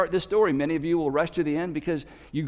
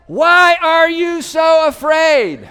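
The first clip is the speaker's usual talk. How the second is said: slow, loud, high shout with a laugh; laughing yell; 9 semitones higher, 10 dB louder, much slower than usual. Retold or imitated. Jesus repeats the question, shouting over the storm. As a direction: bellow it again, amused. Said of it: "He's having to yell".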